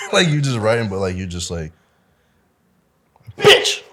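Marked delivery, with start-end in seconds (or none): 3.4-3.8 sudden, much higher, much louder curse; yelled curse. Retold or imitated. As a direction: blurt it out as loud as you can. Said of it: "yell a curse word as loud as we could".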